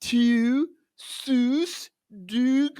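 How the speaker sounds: mock Baltimore accent, high, drawn-out vowels; hammed-up Baltimore vowels. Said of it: "Baltimore's annoying sounding".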